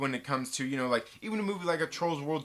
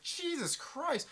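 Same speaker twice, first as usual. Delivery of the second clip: higher, slow disbelief at the spending; a mock incredulous outburst, higher; 9 semitones higher than usual. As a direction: gasp out Jesus Christ in shock. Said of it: "and it's like".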